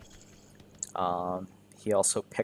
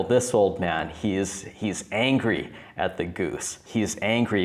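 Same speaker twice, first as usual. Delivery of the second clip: ordinary talk, nothing marked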